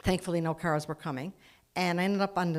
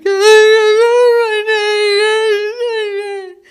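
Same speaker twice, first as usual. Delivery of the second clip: loud, high, drawn-out mock wail; losing it; 18 dB louder, much slower than usual. Crash victim to the paramedic asking her name, hysterical wail. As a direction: wail it slowly in hammed-up hysterics. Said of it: "I just lose it".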